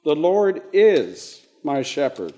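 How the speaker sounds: is punched and falling, pause after; stress on is. Shown as a demonstration. Teacher repeats the changed verse, stress on is. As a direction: lean on is, then pause. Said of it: "what does that do".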